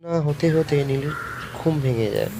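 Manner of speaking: ordinary delivery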